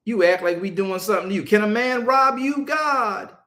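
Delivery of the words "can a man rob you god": higher, louder snotty back-talk; mocking arrogance. Preacher mimicking the chosen people sassing God, mock arrogant. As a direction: throw it back like a child talking back. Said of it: "these jokers actually said".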